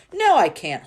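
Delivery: loud, high schoolgirl retort; excited schoolgirl. A narrator's reading. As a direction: snap it back boastfully. Hortensina voice again.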